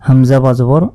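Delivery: ordinary delivery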